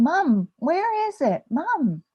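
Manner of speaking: put-on high, drawn-out child's call; little boy calling mom. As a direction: pester mom like an impatient four-year-old. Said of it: "he's looking and he's saying".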